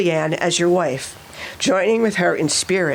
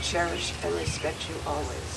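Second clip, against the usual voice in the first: softly fed vow line; quiet prompting; 3 semitones lower, 12 dB quieter than usual. Real, not acted. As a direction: murmur the words for repeating. Officiant feeds vow lines.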